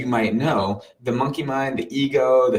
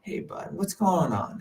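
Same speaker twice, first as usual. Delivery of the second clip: put-on high, soft and tender, like soothing a child; higher self calming the monkey mind, high and soft; 3 semitones higher, 5 dB quieter, slower than usual. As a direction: gently comfort your anxious little self, pitched up and hushed. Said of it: "I came in as my higher self and I said".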